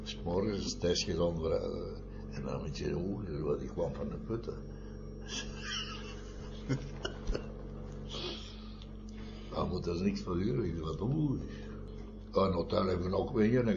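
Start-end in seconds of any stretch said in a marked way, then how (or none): 4.6-8.4 a high breathy laugh that fades out; soft airy laugh trailing away; long laugh. Real, not acted. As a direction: let the laugh run out quietly. Laughs after mining memory.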